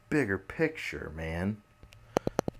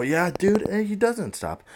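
pitched way up and louder, worked up; excited, much higher; 13 semitones higher, 6 dB louder than usual. Real, not acted. Telling viewers about another Trump tweet, pitched up and excited. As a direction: blurt it out, can't believe it.

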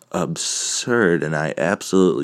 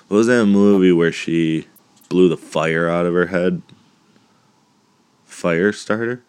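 ordinary delivery

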